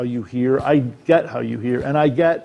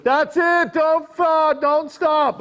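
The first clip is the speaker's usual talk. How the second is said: much higher, thin director's voice calling out; a retold director, pitched way up; imitating the director; 12 semitones higher, much slower than usual. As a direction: lightly mimic the director egging him on from the back. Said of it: "And he was like".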